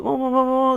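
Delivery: high mock wail, imitating a wife crying. (tiruan tangisan isteri, nada tinggi)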